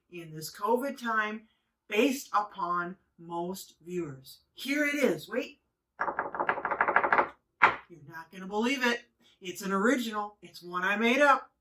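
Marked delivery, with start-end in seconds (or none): none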